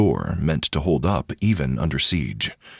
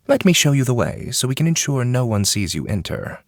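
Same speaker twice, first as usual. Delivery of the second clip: higher, polite courtier voice, eager to please; an eager man hurrying a guest along, raised pitch; Ketsu, eager servant; 7 semitones higher than usual. A narrator's reading.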